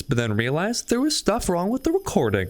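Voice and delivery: usual speaking voice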